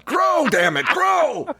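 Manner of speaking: high, strained comic yell; mock yelling. Co-host acting out workers yelling at lobsters in a tank, mock yell. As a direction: yell it up high like a silly command. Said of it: "they yell at 'em".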